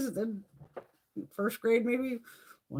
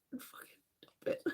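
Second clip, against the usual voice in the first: whispered conspiratorial tip; voiceless whispered aside; 13 dB quieter than usual. Retold or imitated. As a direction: breathe it out like a secret shortcut. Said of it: "you just go".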